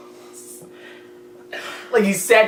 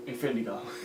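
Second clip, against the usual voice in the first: deadpan mumble; 7 semitones lower, 15 dB quieter, much faster than usual. Retold or imitated. Joe quoted again.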